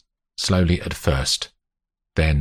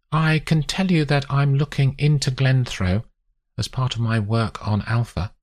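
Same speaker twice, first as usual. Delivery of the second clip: higher character voice, measured disclosure; lightly voiced investigator choosing her words; guarded investigator; 9 semitones higher than usual. Olivia explaining her work to Grace, guarded higher voice.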